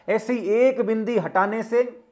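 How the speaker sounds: ordinary talk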